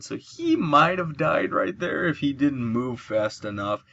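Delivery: ordinary delivery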